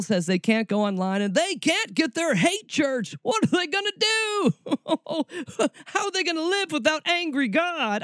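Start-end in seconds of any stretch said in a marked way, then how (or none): 3.2-8.0 high mocking falsetto for the couple; high, far-off mock lament; mock pity. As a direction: whine it like a lost zealot. Mocking the hate church couple.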